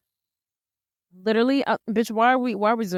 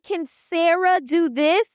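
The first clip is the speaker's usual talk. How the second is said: higher, slow, smug taunt; gloating robot; 7 semitones higher, slower than usual. Retold or imitated. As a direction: rub it in the rival's face.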